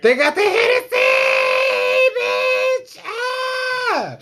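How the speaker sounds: high, loud excited yell; excited shout. Real, not acted. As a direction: shout it in delight.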